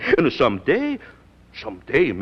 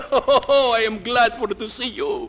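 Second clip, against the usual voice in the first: overjoyed greeting; 8 semitones higher, 5 dB louder, slower than usual. Acted. Tony overjoyed to see Midge.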